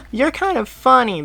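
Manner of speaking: put-on high, amused kid line; chuckling boy. A narrator's reading. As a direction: tease him with a chuckle. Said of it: "the boy chuckled".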